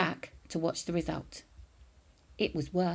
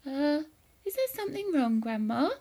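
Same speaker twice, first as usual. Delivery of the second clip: much higher, innocent polite query; mock-innocent polite boy; politely; 7 semitones higher than usual. A narrator's reading. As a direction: ask sweetly, feigning concern. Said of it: "asked her politely".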